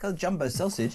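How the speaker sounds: ordinary talk, nothing marked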